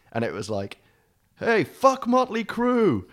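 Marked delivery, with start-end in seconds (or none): 1.4-3.0 high, slow, drawn-out yell; mock yell. Taunting the rival band in a high drawn-out yell. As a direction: jeer it across the street, slow.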